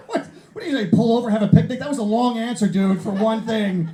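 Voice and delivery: high, loud disbelief; incredulous heckling back. Real, not acted. Comic replying to an audience answer, incredulous and loud. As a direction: call out the audience in loud mock amazement.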